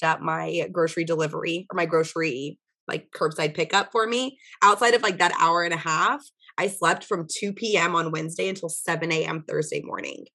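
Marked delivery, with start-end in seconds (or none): none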